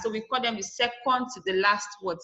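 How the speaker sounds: normal talking voice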